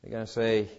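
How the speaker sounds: usual speaking voice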